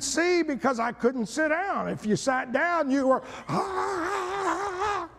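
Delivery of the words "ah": retold groan in the student section; mock pained cry. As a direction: let out a little mock wail. Shout when sitting down.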